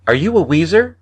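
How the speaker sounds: put-on high child voice, half scared, half curious; a little boy asking with wary wonder; fearful fascinated boy. A narrator's reading. Boy questions coughing stranger, high wary curious child voice. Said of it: "a mixture of fear and fascination in his voice".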